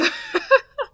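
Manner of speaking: high squealing laugh. Real, not acted. Laughing at her cat during recording, high squealing laugh.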